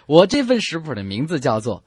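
ordinary talk, nothing marked

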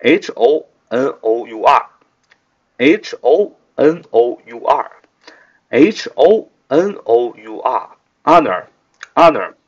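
slowly spelled letter by letter; slow spelling. Shown as a demonstration. Teacher spelling the word for students, slow and deliberate. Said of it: "我们今天学习的第一个单词是honor".

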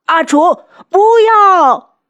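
high, loud shout of alarm; drawn-out, panicked yell; desperate cry. A narrator's reading. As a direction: scream to stop a loved one. Desperate cry as wife attempts suicide.

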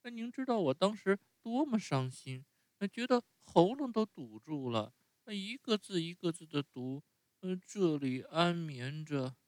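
高出许多的童声，带着伤感; 像孩子诉说委屈那样慢下来; 木偶伤心回忆. Acted. Narrator voices Pinocchio. (用很高的童声伤心地回忆)

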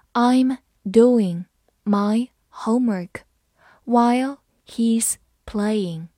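slow, word by word; slow reading. Shown as a demonstration. Teacher reading slowly for students, slow and clear. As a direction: read it slowly for learners. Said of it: "好，我们慢读一遍。".

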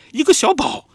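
音调猛地拔高的惊喜叫出; 又惊又喜地脱口喊出来. Retold or imitated. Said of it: "然后比利就惊叹". (弟弟看到刚出生的婴儿，高声惊叹)

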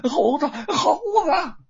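high, loud, drawn-out scream of alarm; panicked yelling. A narrator's reading. Luo Pang fleeing the Monkey King, panicked yell. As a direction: cry out in terror, stretching the words. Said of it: "嘴里大叫着".

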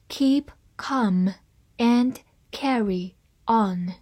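slowly, with a pause between words; slow reading. Shown as a demonstration. Teacher reads the saying for learners, slow reading. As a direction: read it out slowly, word by word. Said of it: "慢慢来读".

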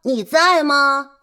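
calling out loudly to the wolf. Acted. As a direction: shout up to him.

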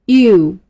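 U said loud and clear as a model; letter demo. Shown as a demonstration. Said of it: "就是我们才学的这个".

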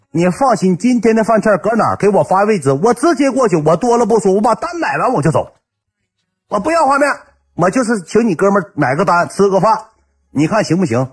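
high-pitched, hammed-up insistence; insistent big talker. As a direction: play a buddy who won't take no. Friend insisting he will pay.

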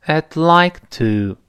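slow, careful teacher demo, linking I'd into like; teacher models a phrase slowly for repeating; slow model reading. Shown as a demonstration. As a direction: spell it out slowly so students copy. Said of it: "跟老师读一下".

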